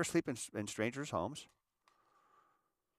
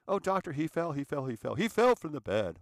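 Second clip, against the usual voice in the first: pleading, higher mock mother voice; mother's fake plea; 5 semitones higher, 5 dB louder than usual. Mother pleading with the doctor, mock worried. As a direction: put on the mother's false worry.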